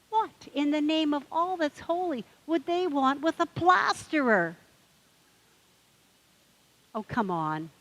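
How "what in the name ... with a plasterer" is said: higher, louder outrage; hammed-up punchline outburst; mock-indignant duck. Duck outraged at the bartender, higher and louder.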